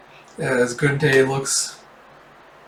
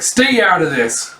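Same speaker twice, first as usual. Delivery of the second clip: high, drawn-out warning; angry warning; 5 semitones higher, 3 dB louder than usual. Acted. Thug to the man stepping in, acted angry warning. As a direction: warn the stranger off, slowly. Thug warns off intervening stranger.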